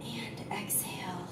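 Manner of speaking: soft whisper while guiding the breath; whispered cue. Real, not acted. Guiding a calm breathing exercise.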